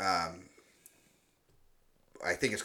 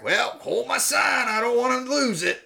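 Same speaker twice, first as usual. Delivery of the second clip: dumb test subject; 13 semitones higher, 5 dB louder than usual. Retold or imitated. Clueless guy in the shark joke, hammed-up high voice. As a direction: play the clueless guy with his sign. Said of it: "He's like".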